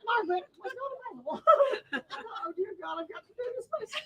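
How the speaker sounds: high, soft words squeezed out through laughter; can barely talk for laughing; laughing too hard to speak; laughing helplessly. Retold or imitated. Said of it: "laughing so hard".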